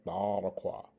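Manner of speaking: Thadakwa opening on TH; alternative pronunciation. Shown as a demonstration. Teacher repeats the alternative for learners, even demo of Thadakwa with TH. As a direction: say Thadakwa evenly, TH instead of Z. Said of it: "the alternative pronunciation is".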